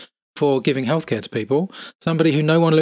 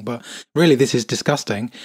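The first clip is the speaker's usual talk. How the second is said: ordinary talk, nothing marked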